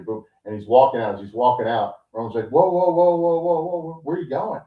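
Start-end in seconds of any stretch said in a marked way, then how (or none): 2.5-4.6 high, insistent string of whoas; raised-pitch protest to halt someone; acted calling-back. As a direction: call after someone walking off. Acting out Roman calling Seth back.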